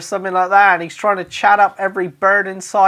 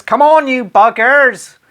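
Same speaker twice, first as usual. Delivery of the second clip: mock shout; 4 semitones higher, 6 dB louder than usual. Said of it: "you might want to shout that".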